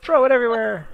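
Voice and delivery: high, loud excited cry; excited shout. Real, not acted. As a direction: call it out with glee. Excited cheer at game play.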